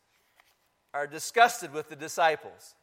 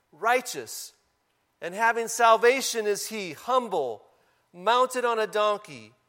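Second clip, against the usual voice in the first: scripture reading; 5 dB louder than usual. Acted. Pastor reading Zechariah to the congregation, proclaiming.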